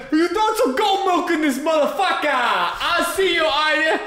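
hammed-up New York accent. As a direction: shout it like a cartoon New Yorker, stretching "I see you". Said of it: "This, I'm in New York now".

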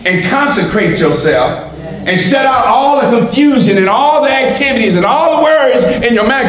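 ordinary talk

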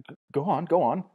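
eager, pressing him on; excitedly. A narrator's reading. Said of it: "said Bernard excitedly".

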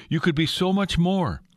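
rising temptation. Acted. Satan's temptation repeated, intensified.

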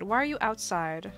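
usual speaking voice

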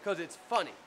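normal talking voice

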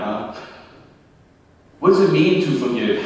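ordinary delivery

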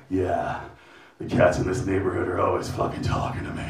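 low, soft deadpan; deadpan mumble. Retold or imitated. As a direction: shrug it off quietly to a stranger. Deadpan reply to stranger.

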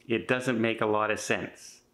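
ordinary talk

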